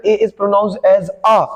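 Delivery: slowly, first "A" as "ay", last "a" as "uh". Shown as a demonstration. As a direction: teach it slowly, landing on "uh". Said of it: "not a". (पहला A "ए", आखिरी a "अ")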